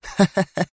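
short, high, scornful laugh; brief edgy laugh of derision; mocking nervous laugh. A narrator's reading. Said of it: "أطلقت ضحكة ساخرة عصبية". (ضحكة ساخرة عصبية)

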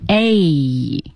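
"A" gliding from eh to ee; diphthong. Shown as a demonstration. Teacher isolating the double vowel, drawn-out glide. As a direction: draw "A" out into an ee. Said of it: "change有一个双元音".

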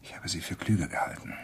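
hushed, breathy whisper under threat; tense whispered reproach. Acted. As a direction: barely voice it, tense. Cornered man faces killer.

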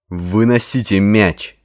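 громкий медленный рявк тренера; рявкнуть команду через всё поле. A narrator's reading. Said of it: "рявкнул Ваймак". (тренер рявкает игрокам из технической зоны)

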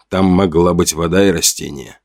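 ordinary delivery, nothing marked

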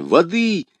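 high, urgent calls; crying out. A narrator's reading. D'Artagnan calls for water for her, urgent cry. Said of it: "кричал Д'Артаньян".

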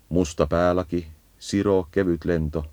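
ordinary talk

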